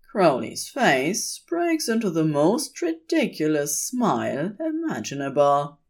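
plain reading voice

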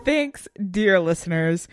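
ordinary talk

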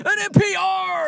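high, hammed-up commentator cry; mock sports announcer. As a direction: shout it like the game-winning play.

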